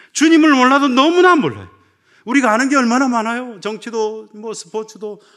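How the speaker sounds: loud, high fervent preaching climb; preaching at full force. Acted. A pastor pressing his congregation, loud fervent preaching. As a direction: hammer the question at the pews.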